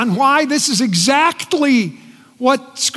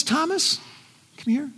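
gentle invitation; 3 semitones higher, 8 dB quieter than usual. Acted. Jesus invites Thomas.

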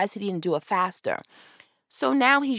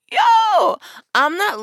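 laughing; 12 semitones higher, 8 dB louder, much slower than usual. Real, not acted. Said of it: "I'm laughing at how sis".

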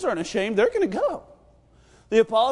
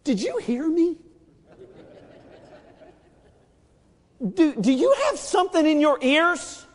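higher exasperated mother; scolding mom; 5 semitones higher than usual. Retold or imitated. Mom scolding a child who won't listen, exasperated. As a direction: scold like a fed-up parent. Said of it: "That's like your mom saying".

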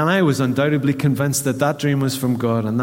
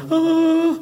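much higher startled gasp; mock startle. Pastor acting out a security light switching on, startled. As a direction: jump like a light just caught you.

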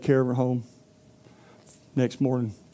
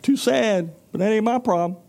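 higher, careless shrug; couldn't care less; dismissive cow; 5 semitones higher than usual. Acted. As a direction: cow turning the mouse away, careless and higher.

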